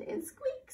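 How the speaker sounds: a very high squeak; squeaky lantern. Acted. Storyteller imitates lanterns squeaking, very high squeak.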